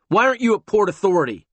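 loud, fast, impatient cop; gruff, impatient. Acted. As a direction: snap it out impatiently. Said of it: "gruff and impatient".

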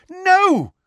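high, loud desperate cry; cry of alarm. A narrator's reading. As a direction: cry out in horror. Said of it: "cried Hermione".

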